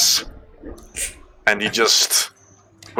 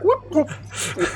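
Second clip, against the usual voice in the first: very high, squeaky whoops; awkward whoop; 4 dB quieter than usual. Acted. Player's character cheering awkwardly, very high whoops. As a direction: let out a confused little cheer. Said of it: "just like".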